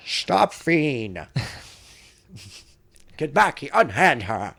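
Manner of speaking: hammed-up heroic commands; old-time hero. Playing the hero in a mock cartoon, hammed-up.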